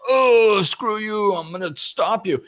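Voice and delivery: loud, high, hammed-up snarl; angry rock person. Angry person blocking someone's way, loud mock snarl. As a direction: bark it like a bully blocking the road. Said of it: "And they go".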